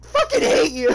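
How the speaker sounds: very high squeal after a laughing fit; laughing protest. Real, not acted. Gamer protests at a joke, high laughing shout.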